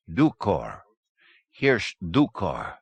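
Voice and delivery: put-on slow, with a light Slovenian accent. A narrator's reading. Hidden persona naming himself to the doctor, slow Slovenian accent. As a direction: give the name slowly, subtle Slovenian accent. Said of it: "pronounced slowly, flavored with a subtle Slovenian accent".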